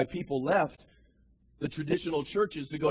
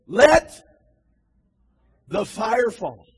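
loud, higher rising cry peaking on let; 7 semitones higher, 11 dB louder, much slower than usual. Acted. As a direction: cry out to heaven with full force. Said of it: "we need to cry out".

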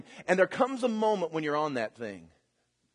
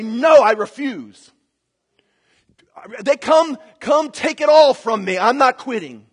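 loud, higher, fierce refusal; defiant vow; 7 semitones higher, 11 dB louder than usual. Acted. Believer vowing to God never to quit, loud defiant declaration. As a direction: plant your feet and refuse to budge.

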